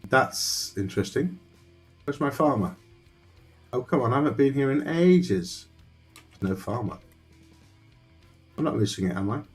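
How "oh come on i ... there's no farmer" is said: usual speaking voice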